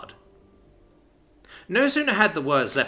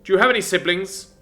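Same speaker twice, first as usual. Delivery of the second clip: father voice; 6 semitones higher, 8 dB louder than usual. Acted. Father asks.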